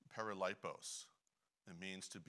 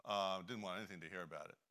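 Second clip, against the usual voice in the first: ordinary delivery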